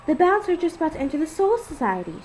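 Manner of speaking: a hint of a bouncy little girl; squealing. A narrator's reading. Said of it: "she squeals as she skips".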